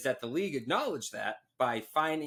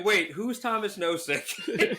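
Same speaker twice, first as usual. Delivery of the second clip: higher, slow, puzzled fan; confused fan voice; 7 semitones higher, slower than usual. Retold or imitated. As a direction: play a baffled fan, laughing. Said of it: "There were Bruins fans going".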